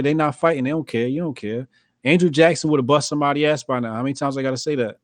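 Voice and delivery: ordinary delivery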